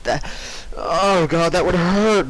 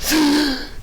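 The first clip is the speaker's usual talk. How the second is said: gasp; 13 semitones higher than usual. Acted. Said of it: "She woke with a gasp".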